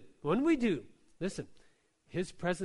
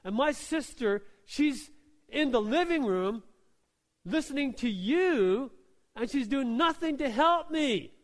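higher and louder, a flustered busy woman whining; exasperated complaining; 5 semitones higher, 4 dB louder than usual. Retold or imitated. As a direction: vent to Jesus about the lazy sister. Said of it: "She says".

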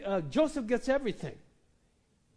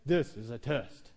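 low, slow, official test-announcement voice; announcing voice; 7 semitones lower than usual. Retold or imitated. Preacher imitating a voice announcing a test. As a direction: intone it like a broadcast warning. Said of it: "There's no voice that says".

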